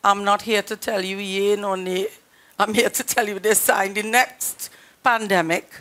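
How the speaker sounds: ordinary talk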